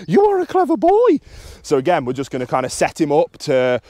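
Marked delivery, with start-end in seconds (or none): 0.1-1.2 loud, very high-pitched gushing; excited dog praise. Real, not acted. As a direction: fuss over a clever dog, way up high. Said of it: "vocal inflection, praise, physical praise".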